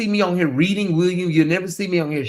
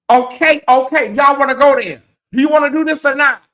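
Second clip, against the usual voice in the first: louder, higher-pitched dare to the listeners; heated challenge; 7 semitones higher, 5 dB louder than usual. Real, not acted. Host asks viewers if they wanna go there, heated challenge. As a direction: call them out, quickening into the last question.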